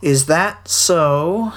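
very slow, drawn-out donkey voice; Eeyore. A narrator's reading. Eeyore answers Roo from the river, slow drawl. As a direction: draw the words right out, unimpressed. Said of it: "said Eeyore".

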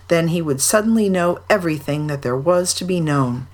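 usual speaking voice

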